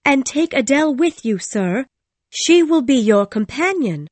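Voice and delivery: loud, high, urgent cutting in; insistent, agitated interjection; interrupting. A narrator's reading. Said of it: "I interrupted".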